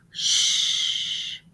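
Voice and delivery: long unvoiced hush; shushing. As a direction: hush them so the bear sleeps on. Hushing children near sleeping bear.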